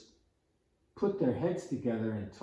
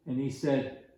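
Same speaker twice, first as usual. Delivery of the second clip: ordinary delivery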